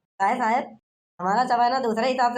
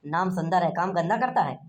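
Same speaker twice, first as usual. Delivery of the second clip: usual speaking voice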